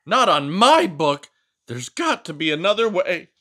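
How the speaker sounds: high, loud indignant scolding voice; fussy book character. Acted. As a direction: scold the reader for snacking. Character panics at smashing fly.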